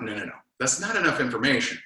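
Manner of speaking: ordinary talk, nothing marked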